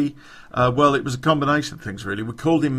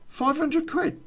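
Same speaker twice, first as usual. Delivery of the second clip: amazed; slower than usual. Retold or imitated. Amazed question at the low price.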